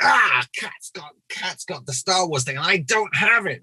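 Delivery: high, slow sulky complaint; hammed-up envy of a friend's job; mock jealous whine. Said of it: "I was so angry".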